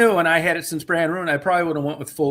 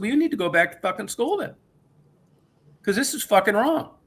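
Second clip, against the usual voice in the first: higher pitched, indignant scolding; angry telling-off; 8 semitones higher than usual. Retold or imitated. Customer tells the oil tech to go back to school, higher and heated. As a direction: scold her like a know-it-all kid. Retold argument, telling her off.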